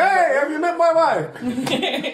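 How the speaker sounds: high, slow mock husband; imitating husband. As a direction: drawl it out like a proud husband.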